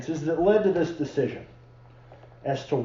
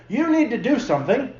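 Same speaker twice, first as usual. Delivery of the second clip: higher-pitched, lightly acted insistence; mock demanding wife; 7 semitones higher than usual. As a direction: mildly play a wife pressing her husband. Moms confronting their husbands.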